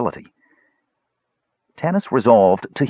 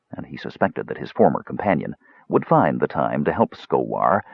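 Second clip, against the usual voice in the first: plain reading voice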